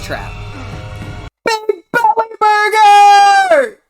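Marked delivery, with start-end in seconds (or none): 1.4-3.8 loud announcement. Acted. Calls out recurring joke.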